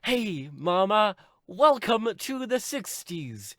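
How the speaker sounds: high, slow, stretched-out cheer; Tracy. Acted. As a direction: welcome Mama with hammed-up glee. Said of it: "And then Tracy is like".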